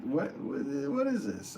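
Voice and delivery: high-pitched mock bafflement; baffled reaction. Streamer retelling hearing an odd accent, baffled reaction. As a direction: re-enact puzzled disbelief, pitch leaping up. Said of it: "I'm like".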